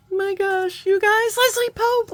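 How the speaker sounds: real high delight at a friend's gift; thrilled squeal. Real, not acted. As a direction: squeal her name in excitement.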